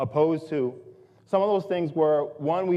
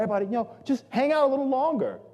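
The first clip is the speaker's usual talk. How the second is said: calling out; 10 semitones higher, faster than usual. Retold or imitated. Organizer keeping diners in the food court, calling out. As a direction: re-enact reassuring a whole room from a high pitch.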